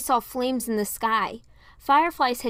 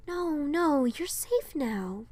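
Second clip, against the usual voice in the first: kind reassurance; 6 semitones higher, much slower than usual. A narrator's reading. Rapunzel tells Piper she is safe, slowly and tenderly. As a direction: hush her fears. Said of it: "kind smile".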